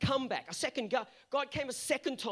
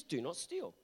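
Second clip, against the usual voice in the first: low, soft, final reading of the commandment; quiet solemn quote; quiet firm command; 7 semitones lower, 5 dB quieter, slower than usual. Shown as a demonstration. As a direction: drop it down and settle it. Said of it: "It says".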